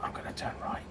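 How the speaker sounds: breathy whisper of a GPS; whispered sat nav voice. Pretend. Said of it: "Say that in a sat nav voice".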